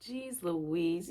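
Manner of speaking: ordinary delivery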